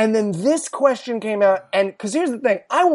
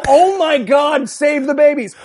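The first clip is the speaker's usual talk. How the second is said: mock alarmed shout; 5 semitones higher than usual. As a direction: cry out in comic alarm. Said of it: "Instead of".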